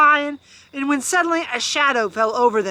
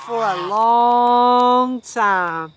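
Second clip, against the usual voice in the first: slow storybook ending; much slower than usual. A narrator's reading. Story closing line.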